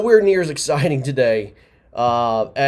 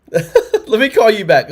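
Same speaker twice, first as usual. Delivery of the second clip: laughing, a bit slow and louder; barely holding it together as he hangs up; 8 semitones higher, 6 dB louder, slower than usual. Real, not acted. Host hanging up on a garbled caller, laughing.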